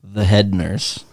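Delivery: drawn-out deadpan punchline; slow punchline. Shown as a demonstration. Comic tells the nurse joke, slow deadpan. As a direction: land the punchline slowly. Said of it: "joke punchline delivery".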